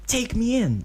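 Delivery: put-on high, eager kid voice; young boy voice. A narrator's reading. Kid calling out to Obito, high boyish voice. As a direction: play the boastful kid.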